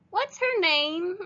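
put-on high, slow small-girl question; little girl voice. A narrator's reading. Teacher voices Stella asking the cowboy, high and slow.

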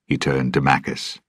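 usual speaking voice

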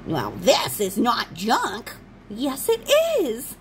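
slow, drawn-out junk lady voice; junk lady impression. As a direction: hold onto the junk like a stubborn old woman. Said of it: "my horrible impersonations".

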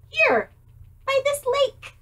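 high mouse voice. Acted.

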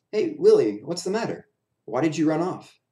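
friendly clown. A narrator's reading. Clown friend finds Willie at the window, concerned. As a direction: call out to a worried pal.

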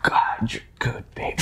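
whisper. Retold or imitated. Imitating the whispered clip.

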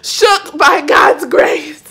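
high, loud, slow laughing cry; joyful shout. Real, not acted. Vlogger crying out her praise, high and loud. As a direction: shout it out, shaken with joy.

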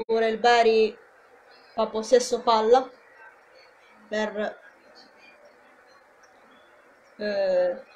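lively play-by-play call; live football commentary. Acted. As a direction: call the match for the fans.

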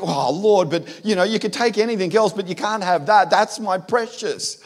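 cling to the treasure, mock-desperate; hammed-up plea to keep it; pleading protest. Acted bargaining with God over treasure.

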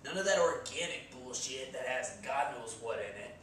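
ordinary talk

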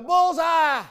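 loud, high shout of "Bullseye"; Joe calling out. Retold or imitated. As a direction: shout it from the front row. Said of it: "He'd go".

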